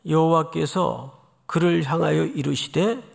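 ordinary talk

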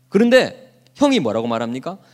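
ordinary talk

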